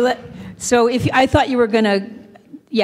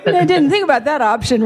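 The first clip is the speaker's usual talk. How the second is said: laughing; 8 semitones higher, 4 dB louder, faster than usual. Retold or imitated. Quoting Ray, laughing.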